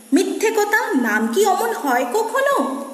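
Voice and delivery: high, swooping protest in verse; mock indignation. Cloud girl disputing a boy's name, high indignant voice. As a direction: recite a lightly angry, disbelieving retort. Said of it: "মেঘবালিকা রেগেই আগুন".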